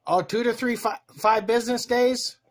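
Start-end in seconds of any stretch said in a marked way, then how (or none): none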